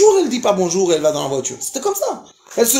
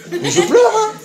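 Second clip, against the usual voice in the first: laughing; 13 semitones higher, 4 dB louder than usual. Real, not acted. Laughing while telling of crying.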